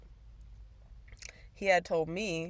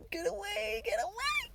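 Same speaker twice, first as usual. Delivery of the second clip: high, frightened pleading at a bug; real panic. Real, not acted. To the roach on the wall, a frightened high cry. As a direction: squeal it away in real fright.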